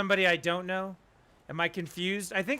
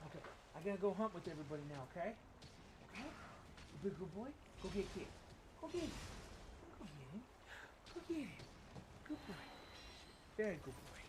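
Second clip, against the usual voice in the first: doting dog talk; 19 dB quieter, faster than usual. Real, not acted. Owner sending his dog to get Kit, soft coaxing pet talk. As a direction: gently coax a pet to run along. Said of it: "if I talk to you like the dog".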